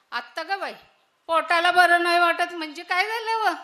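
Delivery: loud, alarmed fuss; alarmed worry. Acted. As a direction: rush in worried and loud. Daughter-in-law alarmed by stomach. (घाबरून मोठ्याने विचारणे)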